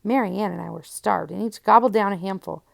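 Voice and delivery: plain reading voice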